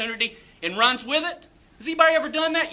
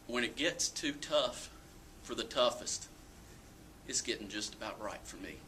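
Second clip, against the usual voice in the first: hushed quote; 11 semitones lower, 15 dB quieter than usual. Retold or imitated. Dad's wisdom quoted, reverent.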